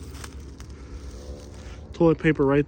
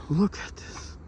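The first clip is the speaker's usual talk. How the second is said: ordinary delivery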